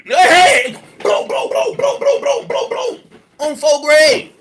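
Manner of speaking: high, loud burst of bro bro bro; excited yelling. Real, not acted. Reacting to the clip on stream, a loud excited burst. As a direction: blurt it out in shocked excitement.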